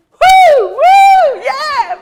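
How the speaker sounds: very high, very loud whooping; real joyful shouted cheers; cheering woo. Real, not acted. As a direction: whoop for your own people. Cheers for Jamaicans in audience.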